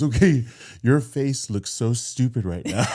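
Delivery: slow retold confession breaking into laughter; can't stop laughing. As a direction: imitate a friend struggling not to crack up. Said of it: "she can't stop laughing".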